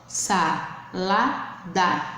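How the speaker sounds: "salada" slowly split, sa... la... da; teacher sounding out "salada" in three beats; syllable by syllable. Shown as a demonstration. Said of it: "formada por três sílabas". ("salada" devagar, sílaba por sílaba)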